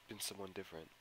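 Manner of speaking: plain reading voice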